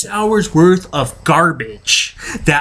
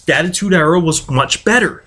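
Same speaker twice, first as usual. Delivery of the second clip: ordinary talk, nothing marked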